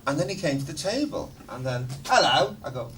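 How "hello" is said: loud high laughing drawl of hello. Retold or imitated. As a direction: camp it up like a rock star greeting. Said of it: "talking like [name]".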